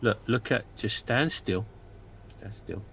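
a spooked dwarf whispering-low to his friend; low hushed dwarf Leopold. Acted. Player voicing Leopold character.

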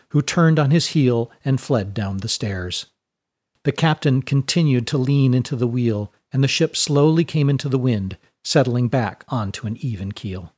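usual speaking voice

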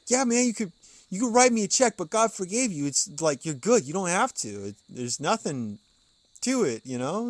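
mock-laid-back pastor waving it off; dad telling the donor he's already forgiven; imitating dad. Dad voiced again in story.

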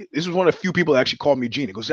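ordinary delivery

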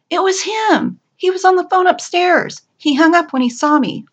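high, excited exclamations; excited report. A narrator's reading. Coworker rushes back with the news.

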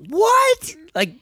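high, loud, drawn-out mock astonishment; astonished disbelief. Hiker reliving the eagle flying by, astonished.

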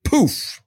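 a playful poof as if something appears; poof sound effect. Shown as a demonstration. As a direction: pop out a magic poof noise. His chosen poof sound effect.

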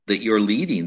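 ordinary talk, nothing marked

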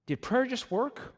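high, slow, disbelieving question; mock astonishment. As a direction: imitate stunned believers, pitched right up. Church professionals astonished, acted out.

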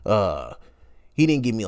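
ordinary delivery

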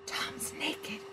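breathy secret whisper, no voice. A narrator's reading. John warning his wife in a whisper. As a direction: hush it so no one else hears. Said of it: "John whispered".

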